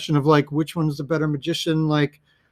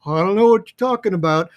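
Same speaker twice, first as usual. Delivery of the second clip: higher-pitched clueless bumpkin; mock bumpkin; 5 semitones higher than usual. Angier answering Borden's hint, mock bumpkin cluelessness. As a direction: play a baffled country bumpkin, pitched up. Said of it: "this kind of bumpkin look".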